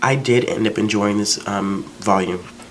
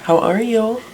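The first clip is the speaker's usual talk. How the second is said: high sing-song greeting; 14 semitones higher, slower than usual. Real, not acted. Calling a cheerful good morning to someone just up. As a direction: greet them in a sugary high voice.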